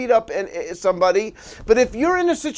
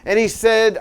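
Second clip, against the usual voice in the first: ordinary delivery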